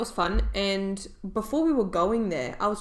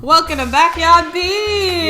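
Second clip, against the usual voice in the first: announcer voice; 9 semitones higher, 12 dB louder, slower than usual. Pretend. Mock grand show opening.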